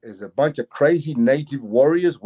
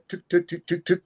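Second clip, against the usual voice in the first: quick, higher tick sounds; knocking imitation; 3 semitones higher, 4 dB quieter than usual. Driver imitating a knock on the car window, tapping sounds. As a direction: imitate sharp little raps on glass. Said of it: "I hear this knock on the window".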